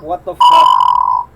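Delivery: low, slow drawn-out disbelief; shocked. Real, not acted. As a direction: stretch it out in shock. Shocked reaction, slowed.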